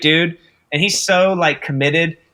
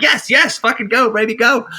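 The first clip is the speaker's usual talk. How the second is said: eager rush; 7 semitones higher than usual. Retold or imitated. Actor eagerly urging the crew to shoot again. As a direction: hurry everyone along, keen to go.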